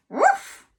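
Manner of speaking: high, loud yappy woof; sharp playful woof; bark. Acted. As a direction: bark it out like a dog. Dog barking in the story.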